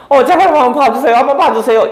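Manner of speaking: a hint of a frightened plea; lightly imitated pleading girl. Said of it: "반응이 어떻게 하기를 원했냐면".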